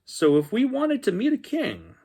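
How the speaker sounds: put-on high, excited little-boy voice; Danny's voice. Danny telling the dinosaur about kings, high and eager.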